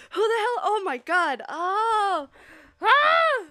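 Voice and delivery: loud, high startled cry; shocked outburst. Real, not acted. Streamer startled by surprise guest in chat, loud shock. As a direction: jump out of your seat in shock.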